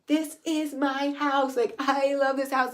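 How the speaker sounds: crying. Retold or imitated. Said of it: "crying and being like".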